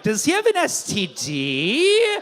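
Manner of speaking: slow, high puzzled question; clueless audience member's wondering voice; mock naive question. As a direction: draw it out, rising high in confusion.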